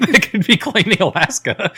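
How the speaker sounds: high, loud, laughing through the words; real laughter bursting into the line. Real, not acted. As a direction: crack up while finishing the sentence. Cracks up at own joke.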